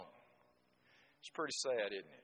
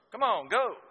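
high, loud frustrated shout at the mule; frustrated urging; 10 semitones higher, 10 dB louder than usual. Retold or imitated. Farmer yelling at his stubborn mule, frustrated shout. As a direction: yell at a mule that won't move. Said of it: "get frustrated with an animal".

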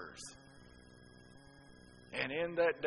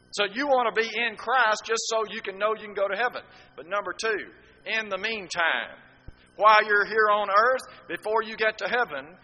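loud, high preaching with the words driven hard; fervent sermon cadence; fiery preaching; 6 semitones higher, 10 dB louder than usual. Acted. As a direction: preach it out to the whole church. Preaching at full force.

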